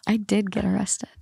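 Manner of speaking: a hushed admission; whispered confession. Real, not acted. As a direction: whisper it, guilty.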